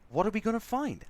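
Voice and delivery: normal talking voice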